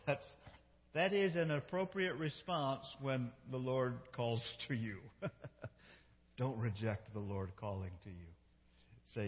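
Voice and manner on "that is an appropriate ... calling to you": ordinary talk, nothing marked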